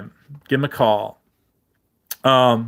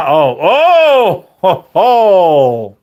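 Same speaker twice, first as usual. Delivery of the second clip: hearty ho ho laugh; 3 semitones higher, 5 dB louder, much slower than usual. Pretend. Loud mock laugh while live.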